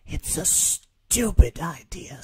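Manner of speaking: slow, scornful creature voice; sneering Zoog. A narrator's reading. The Zoog mocks Rabbit's trap, slow and scornful. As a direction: drag out the scorn slowly.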